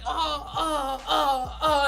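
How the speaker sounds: high, laughing mock grunts; hammed-up climax noises. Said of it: "he's like".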